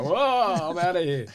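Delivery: high, startled exclamation that settles; startled whoa. Retold or imitated. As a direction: pull back from a monster wave, alarmed. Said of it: "And you go".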